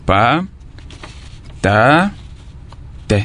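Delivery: "pa, ta, te" split with pauses; teacher chopping "patate" into three beats; syllables cut apart. Shown as a demonstration. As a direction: separate each syllable of "patate". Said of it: "Je découpe ces mots en trois syllabes".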